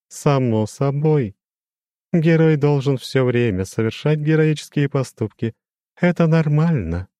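lofty demon with a glassy ring; crystal ringing voice. A narrator's reading. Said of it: "прозвенел он". (высокий звенящий хрустальный голос демона)

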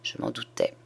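low, soft murmur with head bowed. A narrator's reading. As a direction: murmur it quietly to yourself. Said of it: "il baissa la tête et murmura". (murmure grave, tête baissée)

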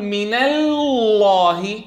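very slow "من الله", lam sliding from thin to thick; faulty recitation of "من الله" with a split, swelling lam; wrong light-then-heavy lam. Shown as a demonstration. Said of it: "كذلك هنا لا نقول".

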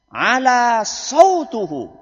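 lantang, nada naik, diulur panjang; suara tinggi. Shown as a demonstration. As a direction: serukan lambat seperti khatib berapi-api. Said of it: "Intonasi suaranya tinggi". (suara lantang dan tinggi)

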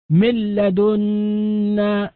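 من لدنا said very slowly, the nun fully merged into a doubled lam, "millada"; slow tajweed demo of من لدنا with no ghunna, the lam doubled; full assimilation demo. Shown as a demonstration. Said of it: "وأصبح النطق بلام مشددة". (نطق من لدنا ببطء بلام مشددة دون غنة)

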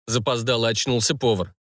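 ordinary delivery, nothing marked

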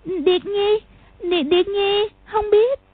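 put-on high, halting frightened child; a scared girl stumbling over her words; girl too frightened to answer the angry man; stammering. A narrator's reading. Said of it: "ấp úng nói".

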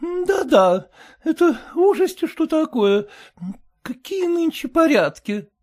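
high, sour, fretful wife voice; high complaining wife; sour merchant's wife. A narrator's reading. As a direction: whine it like a sour housewife. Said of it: "сделала кислое лицо".

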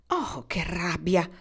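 plain reading voice

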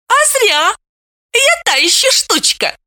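put-on boasting young girl. A narrator's reading. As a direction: brag like a cheeky teenager. Veta boasting.

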